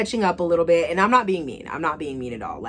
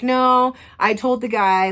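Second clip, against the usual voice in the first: higher, louder mock protest; defensive protest; 7 semitones higher, 6 dB louder, slower than usual. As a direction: jump in defensively. Ex protesting, retold.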